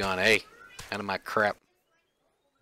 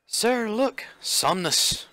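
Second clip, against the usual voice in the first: higher-pitched British guardsman; British accent; 10 semitones higher, much slower than usual. Acted. Guard reporting a find to his superior, British accent. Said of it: "like, British?".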